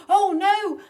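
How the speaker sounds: very high, very loud shriek of panic; panicked cry. Acted. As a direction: yelp it in terror. Jack panics seeing giant.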